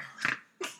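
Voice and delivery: sudden snorting laugh. Real, not acted. Co-host cracking up at a joke about Blake, snort laugh. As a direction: let a laugh escape as a snort. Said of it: "that was a snort from [name]".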